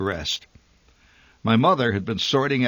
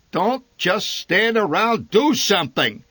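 bellowing father; 11 semitones higher, 6 dB louder than usual. A narrator's reading. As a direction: roar at everyone standing around. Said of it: "he bellowed at everybody".

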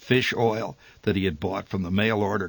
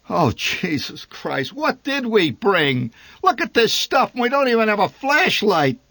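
disgusted at all this stuff; exasperated father; 12 semitones higher, 6 dB louder than usual. A narrator's reading.

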